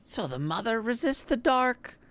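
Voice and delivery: put-on high old granny voice; grandma voice. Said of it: "Love my grandma voice".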